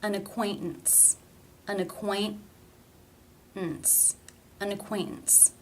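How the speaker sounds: ACQUAINTANCE with the T held back before the N; stop T. Shown as a demonstration. Teacher modelling T before schwa and N, stop in ACQUAINTANCE. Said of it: "it is a stop".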